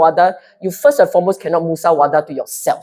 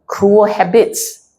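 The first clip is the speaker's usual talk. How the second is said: normal talking voice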